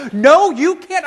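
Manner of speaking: high-pitched, emphatic mock argument; escalating refusal. Professor refuses student yet again, exasperated insistence.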